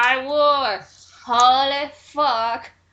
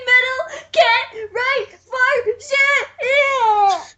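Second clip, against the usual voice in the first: slow, much higher, louder laugh-cry; laughing outburst; 10 semitones higher, 3 dB louder, much slower than usual. Real, not acted. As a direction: crack up and yell it out. Exasperated rant at bad writing.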